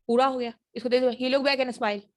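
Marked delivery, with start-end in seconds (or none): 1.1-2.0 "he looked back and smiled" run into one phrase; quick connected speech. Shown as a demonstration. Teacher showing lost sounds to learners, quick and linked. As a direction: say it fast, swallowing the endings of "looked" and "smiled". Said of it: "तेजी से बोलें".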